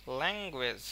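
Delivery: Language with lung stressed, গুয়েজ quick; stress on first syllable. Shown as a demonstration. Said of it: "ল্যাং এর কিন্তু এর উপরে খুব stress". (ল্যাঙ্গুয়েজ-এ ল্যাং-এ জোর, গুয়েজ দ্রুত)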